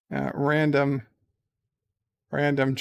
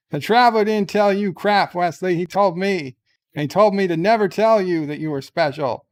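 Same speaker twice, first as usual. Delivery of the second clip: angry captain rant; 4 semitones higher, 6 dB louder, faster than usual. Acted. Captain angrily scolds Wesley.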